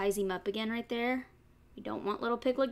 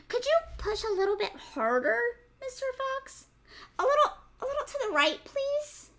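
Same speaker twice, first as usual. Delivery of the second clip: much higher piglet giving directions fast; bossy piglet voice; 9 semitones higher, 3 dB louder than usual. Acted. Piglet directs the tired fox's massage, high and quick. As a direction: boss the fox around sweetly.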